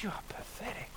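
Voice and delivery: hissed, contemptuous inner voice; a cutting whisper of self-contempt; whispered accusation. Acted. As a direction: sneer it under your breath.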